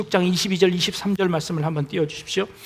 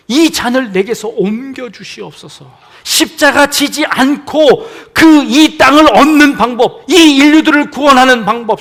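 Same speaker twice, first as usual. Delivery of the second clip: loud, higher pleading cry; anguished prayer; 6 semitones higher, 12 dB louder than usual. Acted. Jesus praying in Gethsemane, anguished loud plea. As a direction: beg from the depths.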